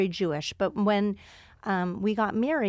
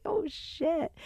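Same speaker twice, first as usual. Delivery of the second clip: startled oh shit; 8 semitones higher, 4 dB quieter than usual. Retold or imitated. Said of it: "I was like".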